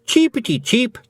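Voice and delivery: high, squeaky Scottish feagle chirping; mock baby bird. Feagle luring the cat, hammed-up high bird squeak.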